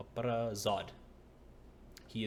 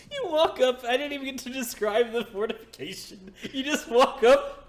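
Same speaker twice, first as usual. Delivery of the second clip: high, loud, laughing exasperation; exasperated outburst; 11 dB louder than usual. Real, not acted. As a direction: cry out in disbelief through laughter. Mock outrage at a player's move.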